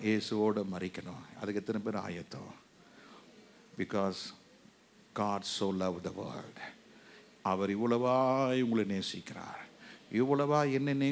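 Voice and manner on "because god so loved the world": lower, slow, soft English verse; soft scripture quote. Acted. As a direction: breathe the verse out slowly.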